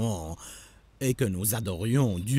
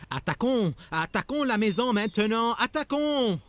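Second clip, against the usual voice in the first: rising, much higher war cry, faster; rallying cry; 11 semitones higher than usual. Acted. Mob leader urges the men to storm Lot's house, rising cry. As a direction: whip the mob into attacking.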